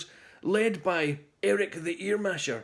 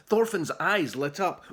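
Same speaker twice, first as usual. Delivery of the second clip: plain reading voice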